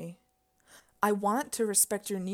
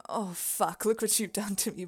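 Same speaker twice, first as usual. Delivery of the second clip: normal talking voice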